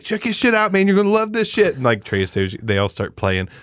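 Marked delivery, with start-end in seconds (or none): 0.0-1.8 high, thrilled stoner buddy voice; mock-excited high voice. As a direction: gush like an overjoyed friend. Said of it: "And he's like".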